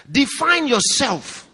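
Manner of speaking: Define yourself slow, high and loud, hammering Define; emphatic command. Acted. Preacher drives point home.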